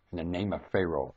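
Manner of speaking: normal talking voice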